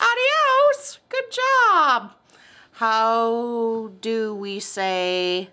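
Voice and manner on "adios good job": excited praise. Real, not acted. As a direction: squeal the praise up high and loud. Mom praises child's Spanish word.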